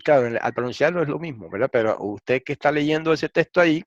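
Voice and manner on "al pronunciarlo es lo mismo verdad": usual speaking voice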